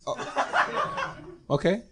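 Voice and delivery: ordinary talk, nothing marked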